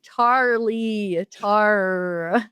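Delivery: "Charlie" with a hard American R, slowed and spelled out; New Zealander forcing an American "Charlie" on the phone; put-on American accent. Shown as a demonstration. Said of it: "I had to force myself to put on an accent".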